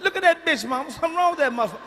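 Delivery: high, loud cry to mama; alarmed child imitation. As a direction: play a kid loudly pointing out trouble. Child self cursing, alerting mama.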